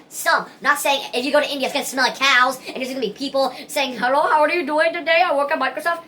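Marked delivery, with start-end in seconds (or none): 4.0-6.0 mock Indian accent, pitched higher. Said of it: "they always do this Indian accent like this".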